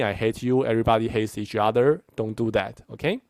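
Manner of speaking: ordinary talk, nothing marked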